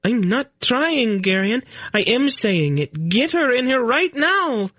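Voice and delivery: put-on a young queen in labour, snapping at her flustered husband. Queen Ce'Nedra to Garion, high and urgent.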